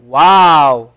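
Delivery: a surprised wow, as the example. Shown as a demonstration. Teacher acts out a surprised wow. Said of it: "when you see something and you're surprised".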